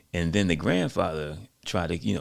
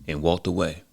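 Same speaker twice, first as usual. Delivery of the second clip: usual speaking voice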